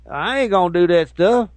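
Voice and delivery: lightly hammed ungrammatical teacher; mock ignorant teacher. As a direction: play the clueless English teacher. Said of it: "keep saying".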